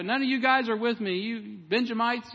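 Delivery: loud, high mock scolding; Saul, all mad. Preacher acts out the king scolding the Benjamites, all mad. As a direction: berate your followers as an angry king. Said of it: "you know, he's all mad".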